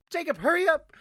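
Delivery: high, urgent mock call; a squeaky urgent mimic. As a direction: imitate a friend hurrying you along. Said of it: "And I hear him go".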